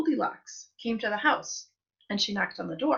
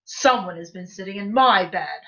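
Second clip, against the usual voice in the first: great big Papa Bear voice; 2 semitones lower, 7 dB louder than usual. A narrator's reading. Papa Bear finds his chair used, great big voice. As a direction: boom it out as an indignant big bear. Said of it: "in his great big voice".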